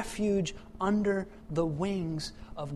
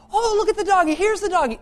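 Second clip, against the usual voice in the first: put-on higher, louder little kid squeal; excited child; 13 dB louder, faster than usual. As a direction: play the delighted toddler. Child voiced in story.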